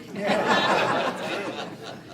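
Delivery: short breathy laugh; real laugh. Real, not acted. Speaker laughs with the crowd about cameras, short real laugh. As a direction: let a quick laugh slip out.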